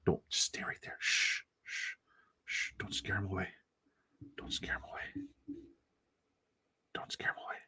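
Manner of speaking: hushed nature-show whisper; wildlife watching whisper. Pretend. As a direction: whisper so you won't scare it off. Not scaring off viewer.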